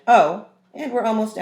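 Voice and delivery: loud, high afterthought; mum calling out. A narrator's reading. Mum calling out to dad as she leaves. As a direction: call it back over your shoulder. Mum's parting line.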